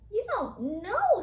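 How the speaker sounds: high squeaky mouse question; little mouse squeaking in surprise. A narrator's reading. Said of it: "squeaked Christopher".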